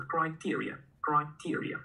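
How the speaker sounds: criteria, spelled out slowly syllable by syllable; slow model pronunciation of criteria for the class to repeat. Shown as a demonstration. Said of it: "跟我读".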